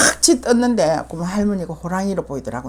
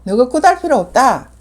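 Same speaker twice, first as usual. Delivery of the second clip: loud high retold command; commanding shout; 6 semitones higher, 6 dB louder than usual. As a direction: bark it out at the shaman. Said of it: "내가 하는 말이야". (큰 소리로 호령하듯)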